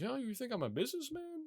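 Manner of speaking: high, indignant question; an offended tech billionaire. Retold or imitated. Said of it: "he gets incredibly offended".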